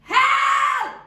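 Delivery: a high, loud panicked scream of "Help!". Acted. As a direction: yell for help as if in danger.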